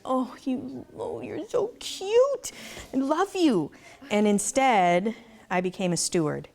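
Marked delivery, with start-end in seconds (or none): 0.0-3.7 put-on high, slow cooing baby talk. Owner gushing over a baby goat, high sweet baby talk. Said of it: "You know, I was like".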